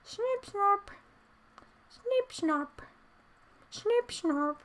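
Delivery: soft squeaky little critter noises; little guy noise. Pretend. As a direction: chirp it like a small critter. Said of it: "new little guy rah things".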